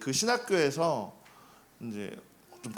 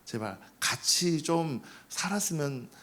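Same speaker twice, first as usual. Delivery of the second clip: softer, hesitant, courteous plea; polite request; 2 semitones lower, 4 dB quieter than usual. Acted. Seminarian politely asks noisy upstairs neighbor for quiet, courteous. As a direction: ask politely, keeping it gentle. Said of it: "정중하게 말한 게 아니라".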